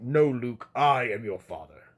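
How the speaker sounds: deep dramatic villain line; Darth Vader impression. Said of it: "it's like".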